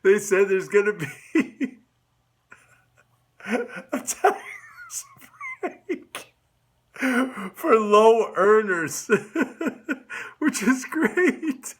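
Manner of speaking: slow, giggling through words; can't stop laughing while talking. Real, not acted. Giggling fit through sentence.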